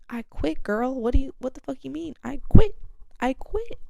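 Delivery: high, loud sassy outrage; sassy disbelief. Retold or imitated. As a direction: throw it back at the boss, incredulous. Retold reply to manager, sassy.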